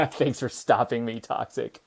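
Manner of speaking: thanks said through laughter; real laughter. Real, not acted. Laughs while thanking a chatter.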